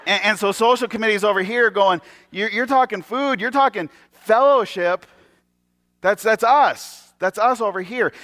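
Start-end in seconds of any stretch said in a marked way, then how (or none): 2.3-8.1 high, slow, drawn-out objection; territorial protest. Acted. Social committee claims food and fellowship as theirs, territorial protest. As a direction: stake the claim in mock indignation.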